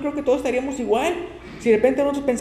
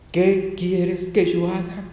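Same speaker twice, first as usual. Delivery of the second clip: humbled plea; 2 semitones lower, much slower than usual. Acted. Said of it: "siempre él... era el mandado".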